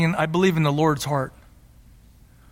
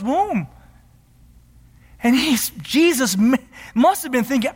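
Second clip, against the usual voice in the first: rising preacher build, higher pitched; preaching; 6 semitones higher than usual. Acted. Pastor exalting Christ to the church, fervent preaching. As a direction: preach it with climbing fervor.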